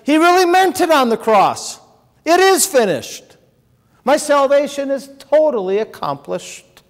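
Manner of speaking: fervent voice of faith; joyful declaration. Acted. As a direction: proclaim it as faith's triumphant shout. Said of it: "and says".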